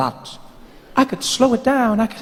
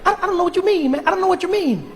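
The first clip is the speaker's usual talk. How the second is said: put-on high young Obama; 11 semitones higher, faster than usual.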